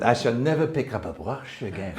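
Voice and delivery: mock French accent, hammed up. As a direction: put on a cod French accent. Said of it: "I'm gonna do a cod French accent".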